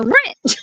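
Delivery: put-on high, drawn "Rich" in a Southern accent. Retelling the school teacher scene, a high Southern "Rich". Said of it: "And I go".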